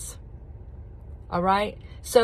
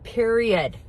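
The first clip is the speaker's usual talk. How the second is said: ordinary talk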